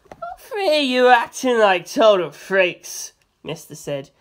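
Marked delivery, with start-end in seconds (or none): 0.1-3.1 slow, loud mocking jeer; heckling jeer. A narrator's reading. Mister heckling the investigators, slow loud jeer. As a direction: drag it out to mock them. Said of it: "cackled wildly".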